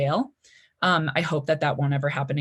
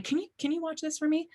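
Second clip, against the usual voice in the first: higher, softer, faster little pleading voice; timid drunk request; 10 semitones higher, 7 dB quieter, faster than usual. Retold or imitated. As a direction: ask sweetly and a bit too fast, like a tipsy girl. Asking bouncer to watch bike.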